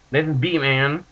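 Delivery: ordinary talk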